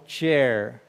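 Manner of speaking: "chair" slowly, with t then sh at the start; slow pronunciation demo. Shown as a demonstration. Teacher breaks chair down for students, slow and clear. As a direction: draw out "chair" to show the t and sh. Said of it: "vai ser ch".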